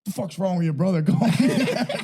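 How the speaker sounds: high, laughing through dad's words; mock dad line broken by laughter; laughing quote. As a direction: crack up while quoting dad. Quoting dad, cracking up.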